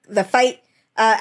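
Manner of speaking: normal talking voice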